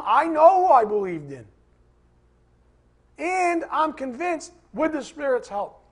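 loud, stress on who; preacher proclaiming his faith; emphatic declaration. Real, not acted. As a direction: declare it with conviction.

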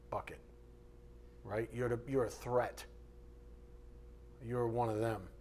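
normal talking voice

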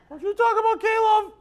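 high, loud, laughing squeal of offense; mock offended listener. Preacher mimicking offended K-Love listeners, laughing. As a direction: play the scandalized fan.

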